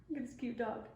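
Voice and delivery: ordinary delivery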